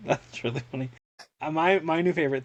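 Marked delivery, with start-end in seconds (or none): none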